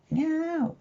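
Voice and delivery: high-pitched kitty meow; cat meow. Shown as a demonstration. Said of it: "What does a cat say?".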